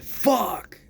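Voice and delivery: sharp loud high cry; retold yelp of pain. Cursing loudly at work after a pan hits his leg. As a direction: yell it like a pan just hit your leg. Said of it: "I said".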